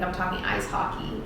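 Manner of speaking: ordinary talk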